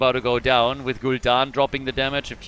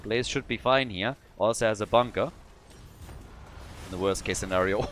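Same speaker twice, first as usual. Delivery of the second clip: ordinary talk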